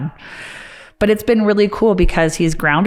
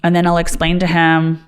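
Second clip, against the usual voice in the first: normal talking voice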